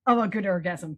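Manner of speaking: ordinary delivery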